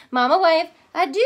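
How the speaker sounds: loud, high Cajun goodbye; calling goodbye. Acted. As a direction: call out across the water. Mama calls goodbye.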